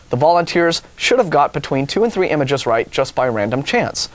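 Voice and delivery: ordinary delivery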